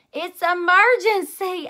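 loud, high, slow desperate shout; shouting at the bathroom door. A narrator's reading. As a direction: plead through the door. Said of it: "I shouted".

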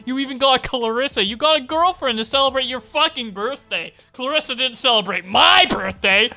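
hammed-up indignant yelling; angry rant. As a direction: shout it like a furious accusation.

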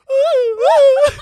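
very high, very loud parody moan; mock high moans. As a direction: squeal the moan like a parrot. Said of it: "keeps going like".

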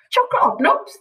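high, rising hopeful question; hopeful child. A narrator's reading. A child on the floor asking Lucy for biscuits, high and hopeful. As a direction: ask it hopefully like a small kid.

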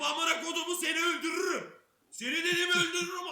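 yelling a death threat at full force; furious shout. Retold or imitated. Soldier screams at his bunkmate, furious shout. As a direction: roar it at the kid you're choking.

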